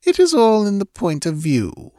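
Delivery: high, lazy and amused; lazy smiling. Acted. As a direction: smile it out lazily. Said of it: "smiled Thalos lazily".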